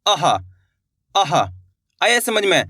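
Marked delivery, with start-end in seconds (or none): 0.1-1.3 "अह" ending in a release of air; releasing air. Shown as a demonstration. Teacher demonstrating for learners, "अह" with air released. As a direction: let breath out at the end of "अह". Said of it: "हवा छोड़नी है आपको मुंह से".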